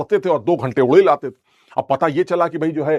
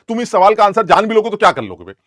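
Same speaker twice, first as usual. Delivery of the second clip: normal talking voice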